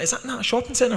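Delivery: a squeaky mock of a confused outsider. Sponsors' confused question mimicked.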